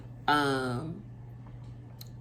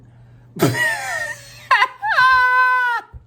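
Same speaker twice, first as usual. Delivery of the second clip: shrieking laughter, much louder and higher; loud laughing fit; 13 dB louder than usual. Real, not acted. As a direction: burst out into a howling laugh. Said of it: "I am not laughing at the topics".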